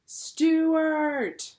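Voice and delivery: high, loud call down a hole. A narrator's reading. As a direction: call his name into the mouse hole. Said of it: "Then they all called".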